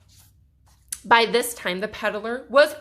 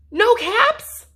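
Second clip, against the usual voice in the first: shocked; 10 semitones higher, 6 dB louder than usual. Acted. Peddler finds caps gone.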